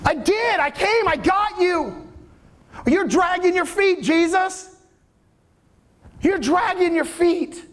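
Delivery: high, accusing complaint; a hurt father scolding Jesus; indignant retort. Acted. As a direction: throw it back at him.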